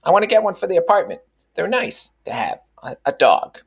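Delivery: high, eager Mrs. Wilson voice; earnest. A narrator's reading. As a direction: pitch it up and plead sincerely. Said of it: "she said earnestly".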